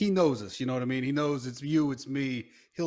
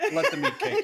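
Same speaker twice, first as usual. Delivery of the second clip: a far higher, laughing, hammed-up motto voice; Frank the Tank; 5 dB louder than usual. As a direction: belt it out as Frank's silly catchphrase. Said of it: "Frank the Tank's motto".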